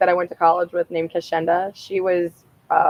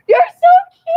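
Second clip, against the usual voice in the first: high, loud squeal of delight; cute-animal squeal; 8 dB louder than usual. Retold or imitated. Cooing at a tiny turtle, high loud squeal. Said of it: "I stopped and I was like".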